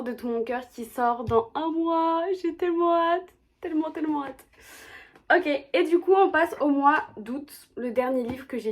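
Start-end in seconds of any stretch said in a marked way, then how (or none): 0.7-4.4 gushing anticipation. Real, not acted. Gushing about an upcoming book. 5.3-7.7 loud, high, over-eager announcement; too loud. Real, not acted. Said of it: "J'ai l'impression de parler tellement fort".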